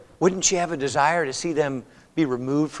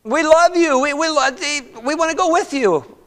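high, emotional acted plea; imitated tearful pleading; 10 semitones higher than usual. Daughters-in-law beg Naomi to let them come, pleading. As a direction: beg to come along, voice pitched right up. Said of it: "they're crying and they're weeping".

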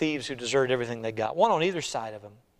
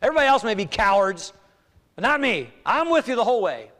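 loud, high, emphatic vow; acted over-confident declaration, hitting each phrase hard; Peter boasting; 7 semitones higher, 7 dB louder than usual. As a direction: swear loyalty with bold self-assurance. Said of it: "That's what Peter said".

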